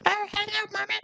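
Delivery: put-on squeaky, very high baby-talk to mom; high-pitched voice. Shown as a demonstration. Talking back to mom, mocking baby-talk in a very high pitch. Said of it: "talk back in a really high-pitched voice".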